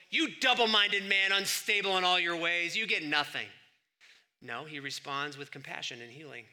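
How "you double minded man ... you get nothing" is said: louder, scolding Jesus who never was; hammed-up stern condemnation; mock harsh rebuke. Preacher imagining Jesus rejecting the father, mock harsh rebuke. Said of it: "is Jesus gonna go".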